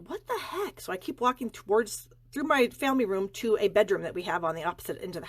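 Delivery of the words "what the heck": much higher, mock bewildered; a startled what-the-heck, pitched way up; baffled exclamation. Walking toward the growing noise, she wonders: baffled high exclamation. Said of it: "I'm like".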